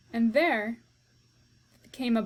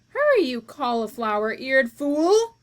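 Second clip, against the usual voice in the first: loud furious insult; angry shout; 3 semitones higher, 8 dB louder than usual. Acted. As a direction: snap the insult. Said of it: "shouted Cook".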